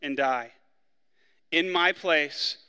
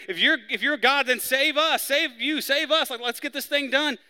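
higher, jeering sneer at Jesus; sarcastic mocking; 10 semitones higher, 4 dB louder than usual. Preacher voicing the criminal mocking Jesus, sarcastic and higher. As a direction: taunt him, save us, mockingly. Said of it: "rails at him and sarcastically".

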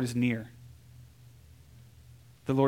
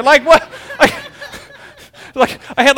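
real laughter breaking through, higher and louder; laughing; 10 semitones higher, 9 dB louder than usual. Real, not acted. Preacher laughing at his own wedding-day panic. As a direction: laugh it off in disbelief.

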